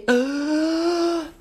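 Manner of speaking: a sharp, high gasp for air after a coughing fit; imitated whooping gasp. Shown as a demonstration. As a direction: suck the air in with a whoop, as if the child can't breathe. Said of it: "haven't been able to get their breath in".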